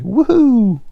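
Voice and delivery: high, loud whoop of joy; cheer. Retold or imitated. Stranded friends cheer the approaching speedboat, loud high whoop. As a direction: whoop like you've just been saved. Cheering at rescue boat arriving.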